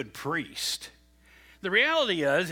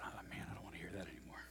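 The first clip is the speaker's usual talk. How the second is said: grumbling whisper; 11 semitones lower, 21 dB quieter, faster than usual. Retold or imitated. Boy grumbles to himself.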